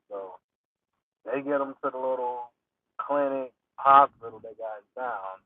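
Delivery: ordinary talk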